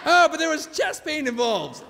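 high, loud, drawn-out objection; pleading protest. Acted. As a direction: plead your case in acted indignation. Protests nurse's panic attack verdict.

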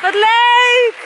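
high, loud playground whoops; loud gleeful squeals on the playground; playful shouting. Real, not acted.

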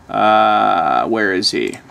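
ordinary talk

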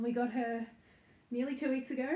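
normal talking voice

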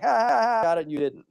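high taunting cackle then gloating; mocking seagull. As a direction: crow over the other gull, mocking. Seagulls mocking each other.

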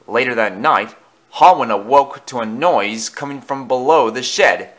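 ordinary delivery

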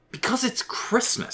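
very high, drawn-out retort; exasperated. A narrator's reading. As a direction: say it slowly and high, as if obvious. Irritated son answers father's question.

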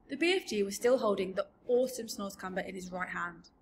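ordinary delivery, nothing marked

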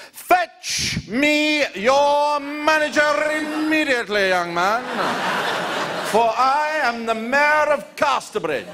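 high, slow, mock-grand demand; posh pompous gent. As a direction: play the outraged mayor of Casterbridge. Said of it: "they become posher".

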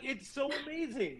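high breathy rush of delight; gushing squeal. Retold or imitated. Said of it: "I was just like".

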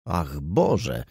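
plain reading voice

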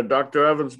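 usual speaking voice

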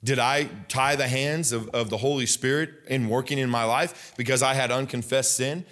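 ordinary talk

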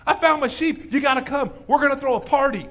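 high, elated celebration; so happy. Retold or imitated. Shepherd calling his friends to celebrate, overjoyed. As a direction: call everyone over in loud, imitated joy. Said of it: "And he's so happy".

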